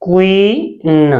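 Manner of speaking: क्वीन slowly, long ee then separate न; sounded out. Shown as a demonstration. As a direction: stretch क्वी, pause, add न. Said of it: "तो इसे हम पढ़ेंगे". (क्वी... न, धीरे-धीरे तोड़कर)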